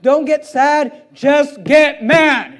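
slow, loud, punched command; emphatic exhortation. Real, not acted. Preacher urging congregation not to get sad, emphatic exhortation. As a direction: land each word hard and slowly.

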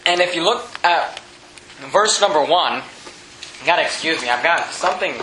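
tickle in the throat; speaking with a scratchy throat; frog in throat. Real, not acted. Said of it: "a tickle or something in my throat".

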